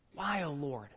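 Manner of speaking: a soft, low, aching question; hushed plea. Acted. Preacher voicing a believer's cry to God, hushed and pleading. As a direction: breathe it out like a private prayer.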